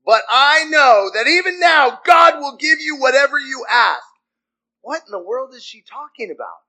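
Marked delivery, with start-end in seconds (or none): 0.0-4.1 high, loud, impassioned plea; desperate insistence. Acted. Martha pleading with Jesus after her brother's death, loud insistence. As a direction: press the demand on him loudly.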